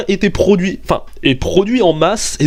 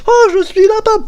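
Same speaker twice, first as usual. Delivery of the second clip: very high squeaky little rabbit voice; silly rabbit. Pretend. The hunted rabbit speaking, high cartoon squeak. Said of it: "t'imagines le lapin?".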